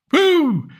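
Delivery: high sarcastic whoop; mock woo. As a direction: ironic celebratory woo. Mock cheer at Smurfs trailer.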